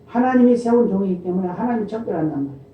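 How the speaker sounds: ordinary talk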